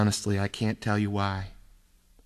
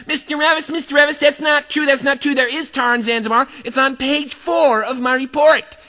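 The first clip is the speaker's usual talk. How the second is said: high, louder whiny protest; tattling girl; 14 semitones higher, 6 dB louder than usual. Retold or imitated. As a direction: pipe up high like a prissy know-it-all. Know-it-all girl protests.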